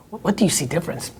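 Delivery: "What do you see difference?" fast and low. Shown as a demonstration. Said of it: "keep Steve around for grammar".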